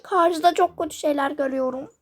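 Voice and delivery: ordinary talk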